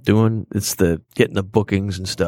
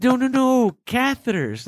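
nurse correcting; 13 semitones higher, slower than usual. Retold or imitated.

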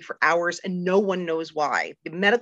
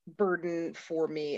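ordinary talk, nothing marked

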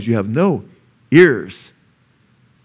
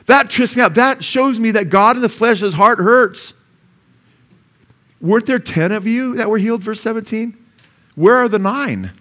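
much higher and louder, fervent; preaching at full force; 10 semitones higher, 4 dB louder than usual. Acted. Preacher crying out to the congregation, fervent and loud. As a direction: preach it out, heart aching.

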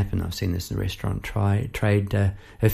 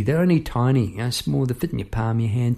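ordinary delivery

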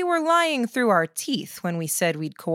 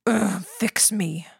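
strained whisper through pain; slower than usual. Acted. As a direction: whisper it, wounded and weak. Badly wounded man begs for help.

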